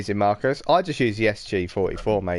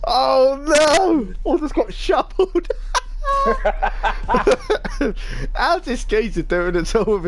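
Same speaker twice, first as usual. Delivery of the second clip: outraged disbelief; 13 semitones higher than usual. Real, not acted. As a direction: howl in outrage at a silly death. Killed with a shovel, outraged.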